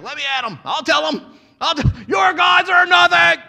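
builds from eager to a loud high shout; itching for a fight, then blurt it out; eager Paul. Retold or imitated. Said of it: "Paul's like".

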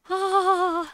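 high, loud surprised oh. Real, not acted. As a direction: gasp it out in surprise. Surprised at board.

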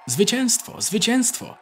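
wysoko, wołając z radością; obwieść zwycięstwo całemu tłumowi; radosny okrzyk. A narrator's reading. Tłum wiwatuje na stacji. (tłum wita wieść z frontu, radosny okrzyk)

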